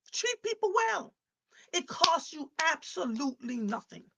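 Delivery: a high, loud, slow exhortation; high ringing appeal. Real, not acted. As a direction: ring it out to the back row. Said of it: "urging audience, repeated".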